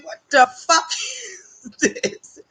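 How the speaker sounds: mock outrage dissolving into laughs; laughing disbelief. Outraged at jail tray food.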